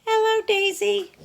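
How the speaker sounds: high, bright little hen voice; Dora the hen. Acted. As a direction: play a chirpy young hen wondering aloud. Clucked Dora.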